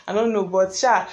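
normal talking voice

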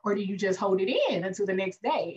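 ordinary talk

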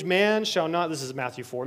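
usual speaking voice

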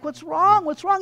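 high-pitched, urgent questioning; alarmed concern. Acted. Young monk finding the old monk sobbing, alarmed.

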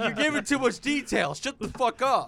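mock annoyed friend. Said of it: "He's like".

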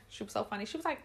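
ordinary delivery

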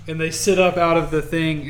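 usual speaking voice

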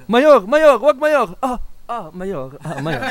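high, loud panicked begging; hammed-up plea to stop; mock pleading cry. Acted prisoner begging the mayor.

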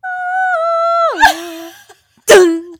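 high held note, then loud dun; sung mimicry of a horror-music cue; imitated creepy music sting. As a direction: vocalise a spooky score's dramatic entrance. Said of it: "the entrance of this stupid music".

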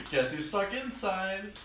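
giggling it out, high and amused; slow, laughing tease. Real, not acted. Teasing friend about the locked gym, laughing.

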